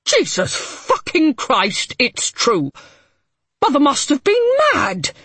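loud, shocked swearing; furious disbelief. A narrator's reading. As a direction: rage at the news.

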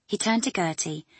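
ordinary delivery

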